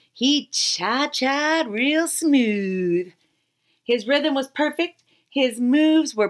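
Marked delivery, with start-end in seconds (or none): 0.1-3.1 smooth and slow. Acted. Dance narrated.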